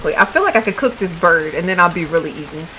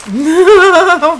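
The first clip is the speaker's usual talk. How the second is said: higher, loud cackle; loud burst of laughter; 8 semitones higher, 9 dB louder than usual. Real, not acted. Streamer laughing at her character's mishap, loud laugh. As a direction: crack up loudly.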